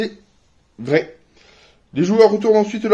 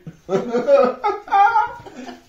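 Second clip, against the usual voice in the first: rire aigu; 10 semitones higher than usual. Real, not acted. Rire après l'échec.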